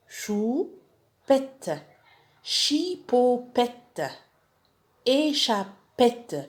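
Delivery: Chipopette and Échappette stretched out very slowly; teacher drilling the names slowly; slow reading drill. Shown as a demonstration. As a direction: drawl each syllable for pupils to copy. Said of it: "تمرنوا عليهم".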